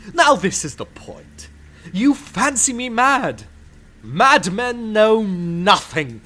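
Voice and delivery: high, loud and building to a pitch; feverish boasting. Acted. Madman bragging to the listener of his caution, rising feverishly. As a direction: let the pride run away with you.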